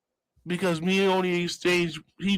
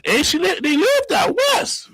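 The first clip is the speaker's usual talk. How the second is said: emphatic exclamation; 13 semitones higher than usual. Real, not acted. Storyteller revealing where they lived, high exclamation.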